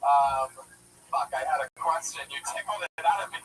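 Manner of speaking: ordinary delivery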